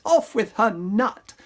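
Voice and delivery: slow, emphatic repeat. Acted. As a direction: stretch the command out. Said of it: "The prince cried".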